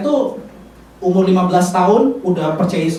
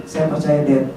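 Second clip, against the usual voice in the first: low, soft retold words of comfort; gentle reassurance; 8 semitones lower, 8 dB quieter than usual. Friend encouraging a fallen preacher, gentle reassurance. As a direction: reassure quietly, dropping pitch and volume.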